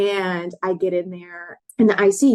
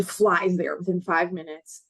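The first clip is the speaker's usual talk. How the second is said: normal talking voice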